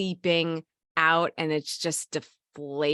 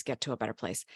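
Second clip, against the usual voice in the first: fading self-talk; 7 semitones lower, 9 dB quieter, faster than usual. Retold or imitated. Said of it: "because you're like".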